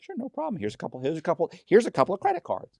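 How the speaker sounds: high, overeager mock salesman; gush, offering every document you own; hammed-up eager helpfulness.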